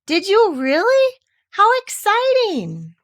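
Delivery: put-on high, slow, thrilled gasp of a boy; wide-eyed delight at the news; excited boy. Christopher Robin thrilled by Eeyore's news, high and excited.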